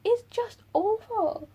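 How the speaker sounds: high, loud, drawn-out outburst; real exasperation. Real, not acted. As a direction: wail it slowly in outrage at how awful he is. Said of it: "He makes me angry".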